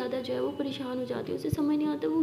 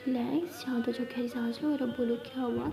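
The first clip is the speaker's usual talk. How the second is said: soft calming; 7 dB quieter than usual. Acted. Abira calming her scared partner, soft and soothing. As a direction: hush her gently, soothe.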